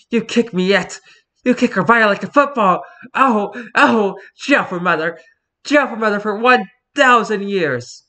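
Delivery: high, indignant melodrama; outraged Victorian child. Acted. Cat accuses owner of kicking, high and outraged. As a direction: cry scandal like a wronged child. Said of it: "cats talk like this disparage of Victorian children".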